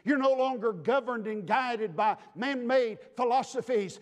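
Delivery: ordinary delivery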